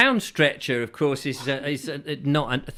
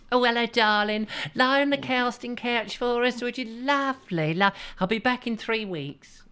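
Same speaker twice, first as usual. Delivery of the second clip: breathy vintage purr; 10 semitones higher than usual. Acted. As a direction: purr it like a saucy film director. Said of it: "your impression of Barbara Windsor".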